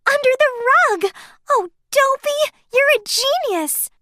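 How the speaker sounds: high, loud, fast delight; excited. A narrator's reading. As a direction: squeal with joy. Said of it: "clapped her hands with excitement".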